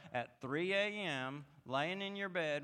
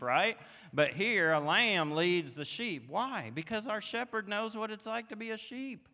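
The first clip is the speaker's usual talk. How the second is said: louder, higher preaching climax with He knows hammered each time; full-force preaching; 3 semitones higher, 6 dB louder than usual. Acted. Pastor driving his congregation to the climax, loud passionate preaching. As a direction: build the He knows lines into a rising proclamation.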